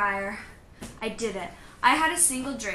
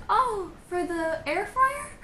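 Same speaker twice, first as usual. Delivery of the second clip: surprised; 9 semitones higher, slower than usual. Retold or imitated. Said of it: "she was so shocked".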